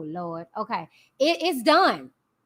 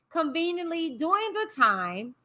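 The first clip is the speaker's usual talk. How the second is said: normal talking voice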